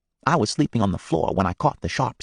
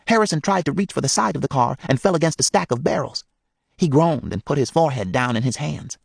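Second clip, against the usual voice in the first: usual speaking voice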